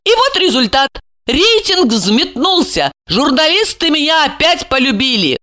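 high-pitched reproachful tirade; indignant, lifted character voice; accusing woman. Acted. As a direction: throw accusations in a high female voice.

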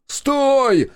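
calling to his back; shout. A narrator's reading. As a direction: yell after him to stop. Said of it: "крикнул ему в спину я".

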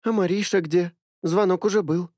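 put-on light girlish question; girl's voice. A narrator's reading. Milya asks. (девичий голос)

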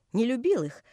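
plain reading voice

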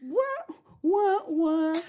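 high mock-sax wah-wah; wailing cartoon horn noise; saxophone "oh no". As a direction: imitate a sad horn with your mouth. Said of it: "that's how we say, "Oh no".

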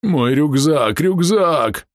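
high wailing lament. A narrator's reading. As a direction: wail it while running away. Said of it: "причитал убегающий полуорк".

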